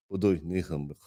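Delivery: tired, sighing repeat of "Budu ni hen ben"; weary practice repeat. Retold or imitated. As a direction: mumble "Budu ni hen ben" to yourself after a long night. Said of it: "I spent the whole night like".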